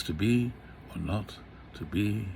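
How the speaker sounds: To be or not to be with the weight on BE and NOT, da-DUM da-DUM; be and not hit, to and or light; iambic stress. Shown as a demonstration. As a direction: tap out To be or not to be in iambs, the second fading soft. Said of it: "it's actually an iambic cadence".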